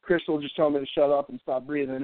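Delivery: ordinary talk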